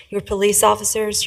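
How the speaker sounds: ordinary delivery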